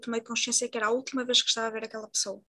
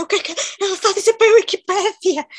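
high, loud mock outrage; shocked by the word Wikipedia; outraged disbelief; 9 semitones higher, 9 dB louder than usual.

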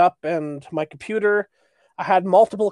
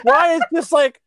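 normal talking voice

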